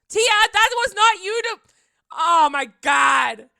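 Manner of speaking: high, very loud disbelief; overwhelmed shouting. Real, not acted. Streamer yelling at viewer over surprise gift, overwhelmed protest. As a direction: shriek at them, then stretch out the exclamation.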